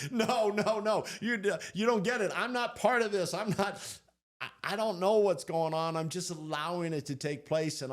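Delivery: high, loud, flustered no-no-no; flustered protest. Retold or imitated. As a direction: wave them off in a panic. Retold protest to the mystics.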